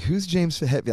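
high, innocent question; son asking. Retold or imitated. Son asks about the rock star, innocently high. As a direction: ask it blankly like a clueless kid. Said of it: "He's like".

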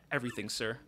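ordinary talk

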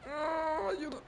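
high, soft fake call for help; high mock cry. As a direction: squeak it out in fake alarm.

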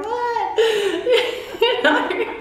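high, laughing disbelief. Real, not acted. Telling the interviewer about the cheering crowd, laughing.

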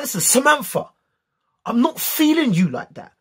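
high, forceful retold rejection; loud and passionate. Boy rejecting Samantha before the whole playground, loud and passionate. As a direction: turn them down loudly, with real vim. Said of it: "I said it so loud and passionately".

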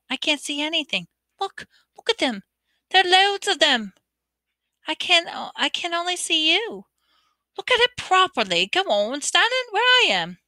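put-on a frustrated boy, rising; Ron's voice. A narrator's reading.

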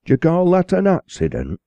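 mock-stern growl at the boy. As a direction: snap the question back at the boy. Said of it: "growled the parson".

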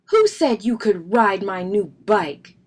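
loud, heated demand; angry shout. A narrator's reading. Brother confronting Fred about his bike, angry shout. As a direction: demand it at full volume. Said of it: "shouted Brother".

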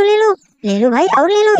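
normal talking voice

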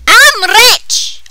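loud high cheer; excited shout. Real, not acted. Kid cheers at game money, shouting. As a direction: celebrate the money.

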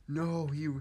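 high, loud, slow shocked cry; shocked dismay. Real, not acted. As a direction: sound dismayed he locked it. Discovers door locked.

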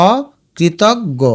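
অকৃতজ্ঞ slowly as o, kri-tog-go; double g. Shown as a demonstration. Teacher explaining the spelling, অকৃতজ্ঞ slowly ending in g-go. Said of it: "আমরা এটাকে গ গ বলি".

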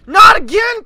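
high, loud cry of despair; exasperated shout. Real, not acted. Gamer shouting at yet another failure. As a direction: yell it out, fed up. Gamer fails once more.

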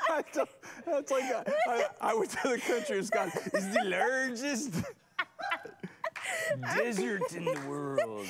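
put-on laughing through country accent. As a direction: try an accent but crack up laughing. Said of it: "accents aren't your thing".

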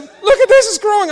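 high, loud amazed cry; astonished. Retold or imitated. Colleague watching his leg grow, high and amazed. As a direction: gasp it out in wonder.

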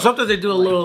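ordinary talk

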